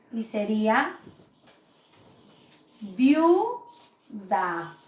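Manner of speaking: viuda said as one syllable, slow and drawn out; syllabified slowly. Shown as a demonstration. Teacher slowly sounding out viuda for the class. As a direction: draw out viuda as a single syllable. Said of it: "Entonces voy a silabiar".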